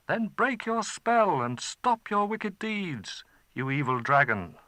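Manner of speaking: put-on a small boy laying down terms; boy demanding the dragon break the spell; stern boy.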